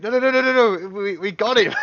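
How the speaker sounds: loud high rapid no no no; guard waving off the others, flustered and loud; frantic reassurance. Acted. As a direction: hurry to calm the guards. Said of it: "he's like".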